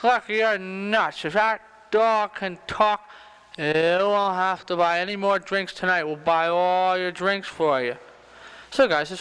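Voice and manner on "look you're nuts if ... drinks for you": put-on slow, dragged-out drunk challenge; drunken barfly. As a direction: heckle slowly like a tough drunk. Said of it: "he's around going".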